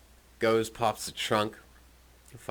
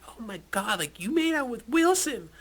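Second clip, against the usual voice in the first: high mock girly voice, lighter than a yell; imitating gossiping girls; 3 dB louder than usual. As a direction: squeal it like teasing party girls. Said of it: "these other girls were like".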